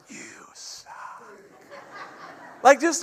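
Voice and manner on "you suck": joking whisper. Retold or imitated. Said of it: "I'm like".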